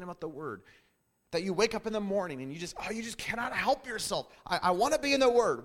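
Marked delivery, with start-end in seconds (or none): 4.4-5.6 higher, louder, eager cry; bursting to get going; a believer waking up eager, higher and louder. Pretend.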